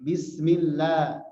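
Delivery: Bismillah sung out slowly, long held vowels; slow drawn bismillah. Shown as a demonstration. Teacher showing students a slow Bismillah. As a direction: stretch Bismillah out slowly in recitation. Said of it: "Nah, itu enggak".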